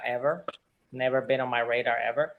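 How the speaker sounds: ordinary delivery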